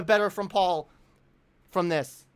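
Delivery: ordinary delivery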